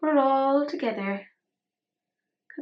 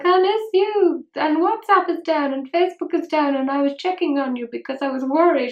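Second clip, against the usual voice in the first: put-on higher, louder doting boyfriend voice; mimicking Sahil; 5 semitones higher, 6 dB louder than usual. Mimicking her boyfriend Sahil on the phone, higher and louder.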